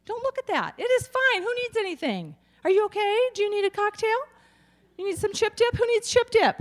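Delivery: high, bright-toned cheerful hostess voice; happy denial mother. Retold or imitated. Mother offering snacks after cutting off her hand. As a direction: chirp along as if nothing happened. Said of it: "My mother's pathologically happy and in denial".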